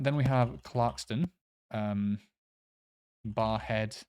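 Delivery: normal talking voice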